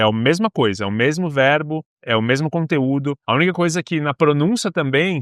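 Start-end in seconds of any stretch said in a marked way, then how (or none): none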